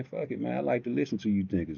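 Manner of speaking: ordinary talk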